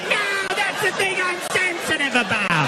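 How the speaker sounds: very high, slow, loud wail; hammed-up anguished cry; wounded protest. Wounded reply to the jeering.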